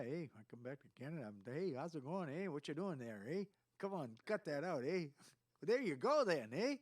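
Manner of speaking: lively, sing-song mock Canadian; Canadian accent. Shown as a demonstration. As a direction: slip into a hammed-up Canadian accent. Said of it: "I start code switching almost immediately".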